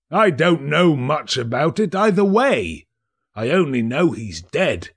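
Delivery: indifferent gossip about a dead man; lightly voiced bored businessman; fat merchant, offhand.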